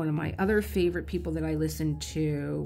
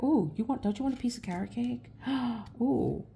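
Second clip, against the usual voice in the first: higher, sing-song coaxing voice; tempting brain voice; 6 semitones higher than usual. Retold or imitated. Her own brain tempting her with cake, in a coaxing high voice. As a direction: coax her like a sweet-toothed devil. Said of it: "My brain".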